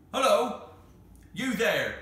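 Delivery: loud call through a door. Acted. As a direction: shout it at the locked door. Gamekeeper calls through door.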